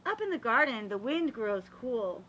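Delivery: usual speaking voice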